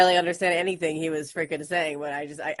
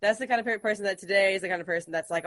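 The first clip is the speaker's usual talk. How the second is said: ordinary delivery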